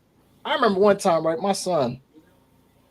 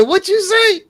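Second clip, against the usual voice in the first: mother's sharp demand; 13 semitones higher, slower than usual. Retold or imitated. Mother confronting son in story.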